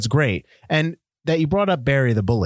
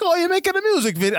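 high, quick attempt at an accent that comes out Italian-sounding; botched accent; faster than usual. Podcaster mimicking the film's father, botched Italian-sounding accent. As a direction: badly imitate the father's accent, pitched way up. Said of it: "I can't even do the accent".

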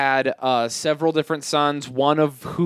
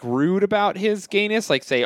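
ordinary delivery

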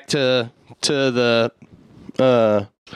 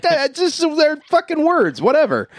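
flustered outburst. Retold or imitated. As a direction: bluster your way out of it. Caught lying about the words.

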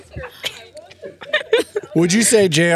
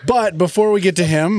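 usual speaking voice

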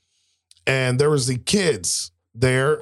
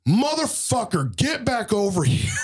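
retold exasperated call; 9 semitones higher than usual. Player calling decoy teammate back, high mock yell.

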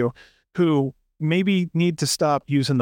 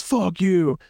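hammed-up actor insult; 5 semitones higher than usual. Mocking actor's corny line.

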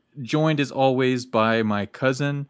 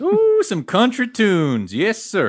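high, whooping country twang; excited, hammed-up cowboy accent; 10 semitones higher, 3 dB louder, slower than usual. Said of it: "in that accent".

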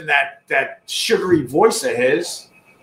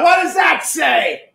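shocked shout; 11 semitones higher, slower than usual. Real, not acted. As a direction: bellow it in disbelief. Shocked at what she read.

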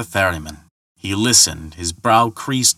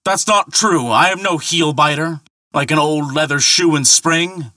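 indignant call; 7 semitones higher than usual. A narrator's reading. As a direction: insist hotly he is no heel-biter. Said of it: "called Thor".

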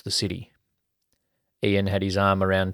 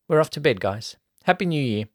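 female character voice; 7 semitones higher, 3 dB louder than usual. A narrator's reading. Tara says good night.

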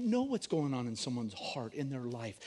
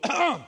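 pointed throat clear; 10 semitones higher, 8 dB louder than usual. Retold or imitated.

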